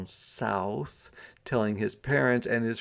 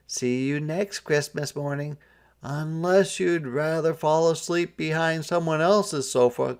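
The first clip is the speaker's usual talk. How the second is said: teasing parents; 6 semitones higher than usual. A narrator's reading. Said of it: "cried his parents".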